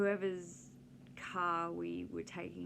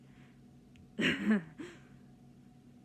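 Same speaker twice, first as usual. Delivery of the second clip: loud real burst of laughter; 3 semitones higher, 7 dB louder than usual. Real, not acted. Laughing at the kids who nearly wet themselves.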